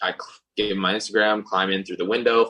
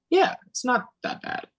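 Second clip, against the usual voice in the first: much higher, breezy carefree voice; mock girl voice. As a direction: shrug off the long swim lightly. Girl voiced, casual about swim.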